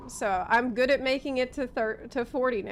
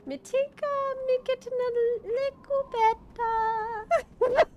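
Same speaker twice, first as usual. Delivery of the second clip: attempted Jamaican accent; 13 semitones higher than usual. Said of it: "practicing the Jamaican accent".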